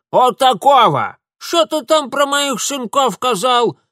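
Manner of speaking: high, loud granny retort; combative old woman. Acted. Old woman challenges Mitya about her sons, combative retort. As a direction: fire back as a quarrelsome villager.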